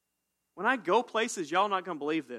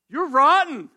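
loud, high mock rebuke; mock harsh accusation; 12 semitones higher, 16 dB louder than usual. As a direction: shout it like a blunt accuser, half joking. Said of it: "Don't tell them that".

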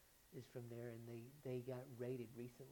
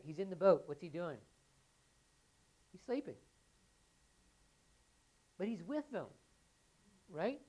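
higher and louder, driving the story home; preaching at full force; 7 semitones higher, 9 dB louder than usual. Acted. Pastor to congregation, fervent preaching. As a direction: build the storm scene for the congregation.

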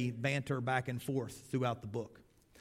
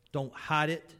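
ordinary talk

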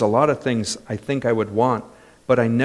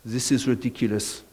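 put-on French accent; 5 semitones lower, 10 dB quieter than usual. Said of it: "in his French accent".

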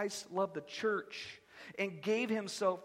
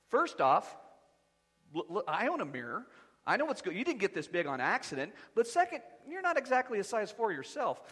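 usual speaking voice

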